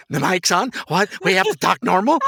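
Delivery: squeaky mock disbelief; mock surprise. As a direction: pretend shock at having to talk normal. Guest jokes about mic.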